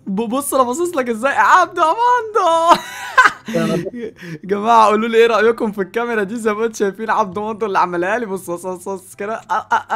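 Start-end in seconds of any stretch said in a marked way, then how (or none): 2.8-3.9 high airy burst of laughter. Real, not acted. Host laughing at his own puppet, a high airy laugh.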